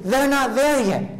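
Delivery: we're not there yet, pitched high and pressed; emphatic point. Shown as a demonstration. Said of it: "The people that have died".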